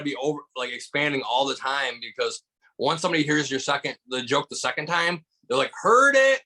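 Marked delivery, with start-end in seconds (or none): 5.8-6.4 loud, high mock heckle; heckler's call-out. Audience calling out over a repeated joke, high heckling shout. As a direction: call it out high like a bored crowd.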